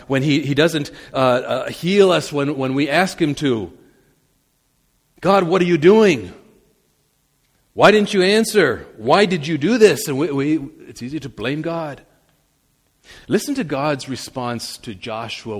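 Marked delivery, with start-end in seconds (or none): 5.2-10.1 demanding outcry. Acted. Believer demanding answers of God.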